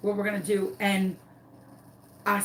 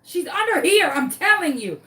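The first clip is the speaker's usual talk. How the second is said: high, loud, insistent little dog voice; dog's voice; 8 semitones higher, 6 dB louder than usual. Retold or imitated. Dog telling her owner the chicken is there, insistent. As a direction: urge Mom like a pet who knows. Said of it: "She's like".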